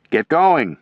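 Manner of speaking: high, loud command to move; yelled order. A narrator's reading. Commander yelling his men onto the bridge. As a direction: push the men forward. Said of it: "Timmerman yelled".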